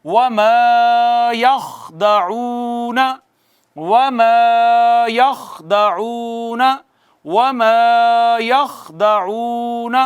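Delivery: وَمَا يَخْدَعُونَ chanted slowly with long و held; slow tajweed recitation. Shown as a demonstration. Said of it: "واو مدہ ہے یہ".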